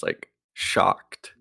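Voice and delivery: usual speaking voice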